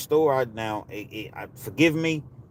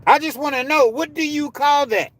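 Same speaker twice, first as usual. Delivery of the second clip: raised, high, insistent shout; outraged demand; 13 semitones higher, 5 dB louder than usual. Real, not acted. Asking doubters what they call that, outraged shout. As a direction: demand an answer at high volume.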